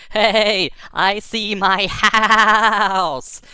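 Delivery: high, loud holler with the last word stretched long; whooping yell, hugely drawn out at the end; excited shout. Acted. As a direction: call out gleefully from way up high. Shouting down from rising rocket.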